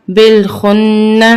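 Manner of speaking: بالخن with long nasal "nn" released into "na"; firm doubled nun. Shown as a demonstration. Teacher showing shaddah, بالخن with firm doubled n. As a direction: hold the n in بالخن firmly, then add "na". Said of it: "اس کو سختی سے پڑھیں گے".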